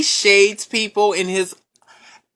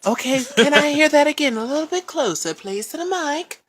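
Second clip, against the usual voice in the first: polite caller; 6 semitones higher than usual. Pretend. Collector politely asking him to repeat.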